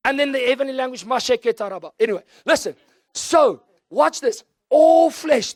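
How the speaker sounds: loud, high preaching peak; full-force preaching. Real, not acted. Preacher at the peak of the sermon, at full force.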